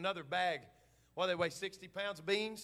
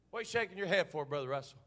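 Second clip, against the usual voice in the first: ordinary talk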